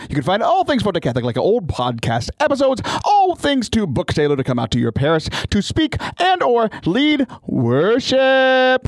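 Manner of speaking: bright, fast promo pitch; upbeat ad read. Acted. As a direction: sell it like a radio spot. Upbeat plug for the show.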